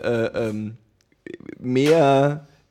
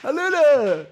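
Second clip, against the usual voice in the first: high-pitched sing-song hello; cheery high greeting; 14 semitones higher, much slower than usual. Acted. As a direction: chirp it out brightly. Greets as introduced fighter.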